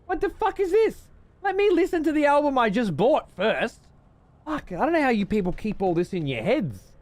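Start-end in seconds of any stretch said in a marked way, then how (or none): 0.0-5.8 exasperated outburst. Real, not acted. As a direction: throw up your hands and protest, pitched way up. Annoyed at music recommendations.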